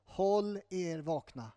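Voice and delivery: slow, deliberate warning; serious exhortation. Acted. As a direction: give each word weight, unhurried and earnest. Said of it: "det är lite mer allvarligt". (allvarlig, eftertrycklig maning)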